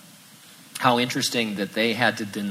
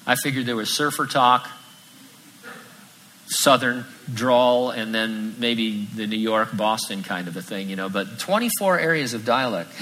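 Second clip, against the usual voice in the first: ordinary delivery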